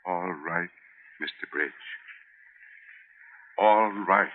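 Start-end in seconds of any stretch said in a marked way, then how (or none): 0.0-1.7 soft, beaten surrender; defeated. Acted. Fired clerk quietly yields to his boss, defeated and soft. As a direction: give in with nothing left.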